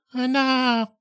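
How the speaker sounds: put-on a touched old father's voice; moved father. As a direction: breathe out his son's name, overcome. Father answers son emotionally.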